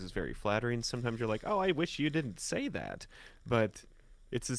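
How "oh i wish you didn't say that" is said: usual speaking voice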